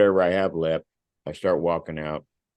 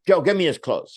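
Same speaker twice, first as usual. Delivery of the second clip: loud, higher call for the clothes; curt order; 10 semitones higher, 4 dB louder than usual. Retold or imitated. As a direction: snap the order to a colleague. Officer orders colleague.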